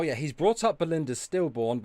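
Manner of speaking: ordinary talk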